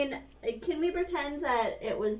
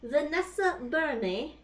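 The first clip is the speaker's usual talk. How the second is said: bad English accent; 6 semitones higher, 4 dB quieter, slower than usual. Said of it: "That was a bad English accent".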